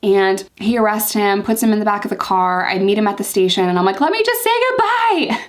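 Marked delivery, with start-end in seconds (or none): 4.0-5.4 high-pitched, hammed-up pleading; dramatic plea. Girlfriend at the station begging to say goodbye, high and dramatic. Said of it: "show up and be dramatic".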